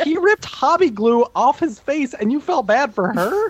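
high, slow, half-laughing disbelief; mock outrage at a friend; incredulous. Real, not acted. Incredulous challenge to co-host.

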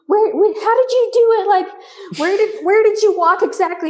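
high eager questions; excited questions. Retold or imitated. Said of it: "[name]'s like".

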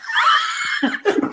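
very high shrieking burst of laughter. Real, not acted. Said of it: "es que no puedo parar".

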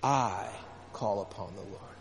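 "I" held alone, then a pause and a soft "call upon the Lord"; stress on I. Shown as a demonstration. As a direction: set "I" apart, let the rest drop away. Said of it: "Let's say it again".

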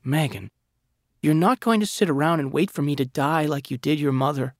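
tearful pleading. A narrator's reading. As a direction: beg him through tears to stop. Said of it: "She was sobbing".

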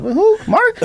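real high-pitched laughing question. Real, not acted. Host teasing co-host about Marky's name, laughing. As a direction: squeak it out through laughter.

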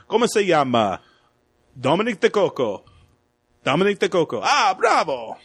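lilting, hammed-up Italian; Italian accent. Podcaster imitating the officer testing the Americans, florid Italian accent. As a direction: relish each word with an Italian flourish. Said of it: "all fast with his Italian".

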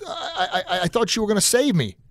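acted bewildered protest to God; a little upset. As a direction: stammer a hurt complaint in a high voice. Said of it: "he's a little upset".

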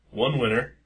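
ordinary delivery

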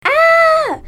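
loud, high-pitched scream of fright; high falling scream. Acted. Falling into the hole, he lets out a loud high scream. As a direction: shriek as the ground gives way. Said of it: "I screamed my heart out".

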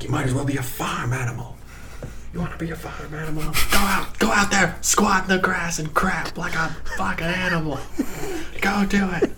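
furious, rising to a shout; raging husband. Acted. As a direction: explode at her with contempt. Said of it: "so he has a rage problem".

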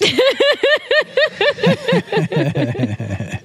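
long high burst of laughter. Real, not acted. Laughs at "my boss" joke.

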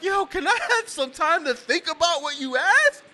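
high, laughing protest; laughing and pleading with his wife; laughing plea. Retold or imitated.

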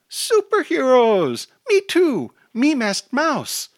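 put-on very high, loud, drawn-out toddler squeal; excited toddler. A narrator's reading. Toddler introduces herself to fellow superheroes, squealing with excitement. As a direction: shout it like an overjoyed small child. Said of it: "the toddler says excitedly".

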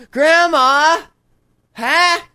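high, loud pleading grandchild; whiny grandkid voice. Pretend. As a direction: squeal it like a needy grandkid. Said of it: "impersonating their grandchildren".

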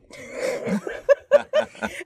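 high, airless burst of laughter; high breathless laugh. Real, not acted. Podcaster laughing at the poison gag, high and breathless.

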